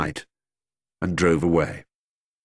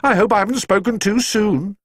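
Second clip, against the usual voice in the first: worried housekeeper; 12 semitones higher than usual. A narrator's reading. Mrs Bird voiced, worried.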